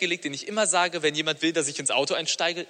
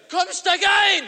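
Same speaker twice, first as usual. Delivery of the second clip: shout; 7 dB louder, much slower than usual. Retold or imitated. Pushy driver yelling at her.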